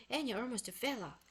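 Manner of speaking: ordinary delivery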